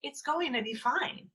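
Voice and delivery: calm reassurance. Retold or imitated. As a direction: settle them down gently. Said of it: "And you say".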